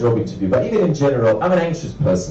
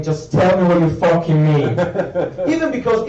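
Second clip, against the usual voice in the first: usual speaking voice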